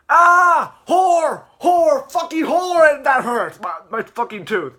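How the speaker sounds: full-out high scream easing into loud wailing; imitated shrieking toothache fit; demonstrated screaming. Shown as a demonstration. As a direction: scream in cartoon agony, then ease off. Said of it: "here's how he screams".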